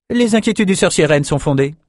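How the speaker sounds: high-pitched, measured woman ruler; high, authoritative council voice; haughty ruling sorceress. A narrator's reading. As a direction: speak as a high-voiced, cautious sovereign.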